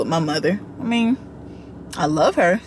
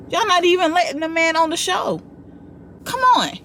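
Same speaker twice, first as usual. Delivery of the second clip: exasperated outburst; 10 semitones higher than usual. Real, not acted. Exasperated at the show.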